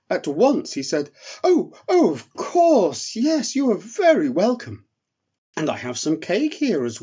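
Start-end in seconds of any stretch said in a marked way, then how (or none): 1.4-7.0 high, eager little elf voice; a small relieved voice rushing to please. Acted. Eric offers the bear soup, high and relieved.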